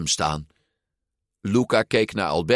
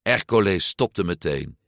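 ordinary delivery, nothing marked

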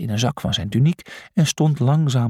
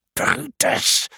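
stressed-out, high whispered shout; loud hiss. A narrator's reading. Julius beside himself with stress, hissing loudly at Brutus. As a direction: hiss the name as a frantic warning. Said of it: "siste hij luid".

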